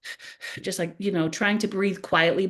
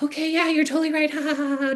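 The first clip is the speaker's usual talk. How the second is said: higher, forced breezy agreement with a fake laugh; faking cool; 9 semitones higher than usual. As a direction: act casual while clearly rattled. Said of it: "trying to be cool".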